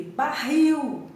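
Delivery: "barril" with -RIL loud and high; stress on final syllable. Shown as a demonstration. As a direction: lean hard on the end of "barril". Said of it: "a última sílaba é a forte". ("barril" com força na última sílaba)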